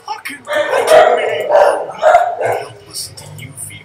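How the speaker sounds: loud, slow, heavy hammered delivery; booming mock-dramatic rant; dramatic shout. Real, not acted. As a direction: drive home the helplessness. Dramatic rant about helplessness.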